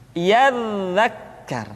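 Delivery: "Yadzakkar" with a long buzzing dz; flowing dz. Shown as a demonstration. Teacher repeating the correct form, "Yadzakkar" with flowing dz. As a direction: let the dz in "Yadzakkar" stream on slowly. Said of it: "suara dialirkan dengan lembut".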